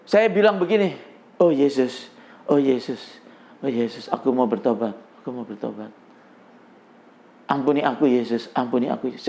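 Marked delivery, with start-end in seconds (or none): 1.4-9.3 low, hushed plea to Jesus; penitent prayer. Retold or imitated. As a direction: drop low and soft, as if begging forgiveness. Retold desperate prayer of repentance. (doa tobat yang lirih dan rendah)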